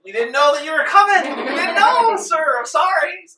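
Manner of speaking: very high, loud panicked apology; Adam Stephen. Acted. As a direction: squeal excuses like a cowed officer. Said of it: "Adam's like".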